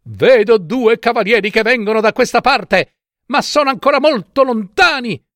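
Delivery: high-pitched excited report; excited sister. A narrator's reading. Sister sights horsemen from the tower, excited. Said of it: "rispose la sorella".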